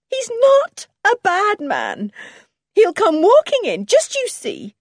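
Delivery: high, loud, overwrought insistence; distraught, high-pitched protest; desperate denial. A narrator's reading. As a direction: insist frantically on his innocence. Wife desperately defends her husband.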